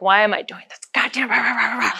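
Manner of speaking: soft grumbling curses to herself; cursing under breath. Retold or imitated. Struggling up the hike, she curses quietly to herself. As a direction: mutter it under your breath, annoyed. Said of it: "cursing myself under the breath".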